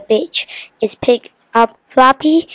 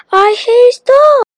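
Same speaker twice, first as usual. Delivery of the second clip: high-pitched cry of protest; 13 semitones higher, 4 dB louder than usual. A narrator's reading. The genie flees the barking dog, crying out. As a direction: wail it up high. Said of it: "cried the genie".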